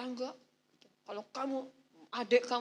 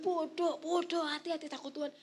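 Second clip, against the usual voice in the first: scolding stupid; 5 semitones higher than usual. Retold or imitated. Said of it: "udah mau bilang".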